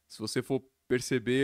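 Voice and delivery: ordinary talk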